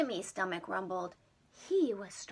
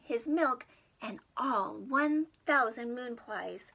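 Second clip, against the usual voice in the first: ordinary delivery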